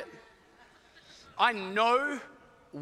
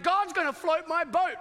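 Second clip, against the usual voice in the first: excited Noah; 7 semitones higher, 3 dB louder than usual. Acted. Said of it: "he's like".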